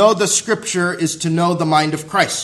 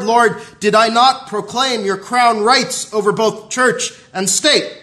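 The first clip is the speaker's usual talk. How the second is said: higher, driving, repeated refrain that climbs; impassioned preaching; 5 semitones higher, 4 dB louder than usual. Acted. Preacher voicing the many who will say Lord, Lord, impassioned. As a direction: press each plea harder than a plain statement. Preaches mounting mock pleas of hypocrites.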